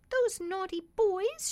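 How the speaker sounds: put-on high, loud tearful child; sobbing. A narrator's reading. Dolly crying to her father about the boys, sobbing high and loud. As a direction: sob it out as a muddied little girl. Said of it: "she sobbed".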